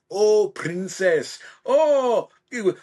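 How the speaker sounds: slow, loud Scottish ogre voice; Shrek impression. Actor practising movie lines as Shrek. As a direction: ham up the ogre with a Scottish burr. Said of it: "just doing the scenes, you know, from the movie".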